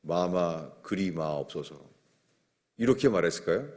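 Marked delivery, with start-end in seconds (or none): 0.0-1.8 soft, hushed courtly plea to the king; bow low and plead like a courtier in a palace drama; lightly voiced historical courtier, softer; period drama courtier. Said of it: "사극에 나오는 것처럼".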